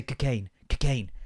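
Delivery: slow, drawn-out "Cocaine, cocaine"; comedian's cocaine impression. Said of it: "he used to say".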